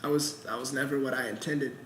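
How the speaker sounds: sheepish, low and barely audible. Acted. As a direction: confess it ashamed, almost under the breath. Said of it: "I sheepishly say".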